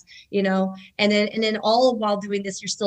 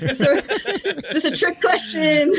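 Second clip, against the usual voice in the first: mock incredulous; 7 semitones higher, 5 dB louder, slower than usual. Mock reply to wife's question.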